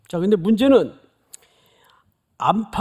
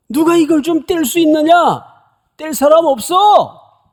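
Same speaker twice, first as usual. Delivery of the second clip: much higher, louder desperate cry; frustrated shout; 11 semitones higher, 7 dB louder than usual. Retold or imitated. Angel crying out in frustration, loud desperate shout. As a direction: shout it out in exasperation. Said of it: "이렇게 소리 지르는 거예요".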